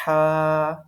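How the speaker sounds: ہا pushed out from the middle of the throat with breath, like after cold water; teacher's model of ہا; breathy mid-throat ha. Shown as a demonstration. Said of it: "آپ نے وہی آواز نکالنے کی کوشش کرنی ہے".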